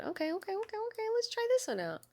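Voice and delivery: high, soft excited muttering; eager self-talk. Real, not acted. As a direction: psyche yourself up quietly.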